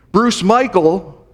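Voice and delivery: stern full-name call, high and drawn out; mother's attention-getting call. Retold or imitated. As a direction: call out the name slowly and loudly to get attention. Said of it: "by the way she said it".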